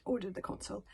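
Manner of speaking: ordinary delivery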